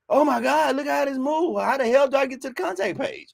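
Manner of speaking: high-pitched mock bewilderment; exasperated website visitor. Speaker mimics a visitor lost on a flashy website, high-pitched exasperation.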